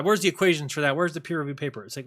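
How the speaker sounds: ordinary talk, nothing marked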